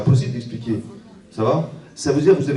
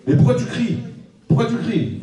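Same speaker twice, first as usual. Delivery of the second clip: baffled husband; 12 semitones higher than usual. Acted. Husband asking his wife in disbelief why she screamed. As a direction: throw up your hands in disbelief.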